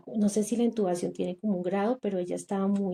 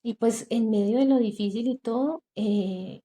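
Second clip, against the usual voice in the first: usual speaking voice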